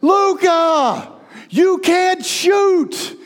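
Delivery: high, loud drawn-out yell; sideline coach shout. Retold or imitated. Coach calls to a young player from the sidelines, shouting. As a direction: holler it across a soccer field. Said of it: "I'm over on the sidelines going".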